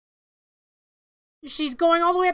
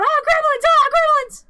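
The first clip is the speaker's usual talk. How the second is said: very high, loud panicked yelp; freaking out; 13 semitones higher, 9 dB louder than usual. Acted. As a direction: shriek in terror at the gremlins. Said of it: "He's freaking out".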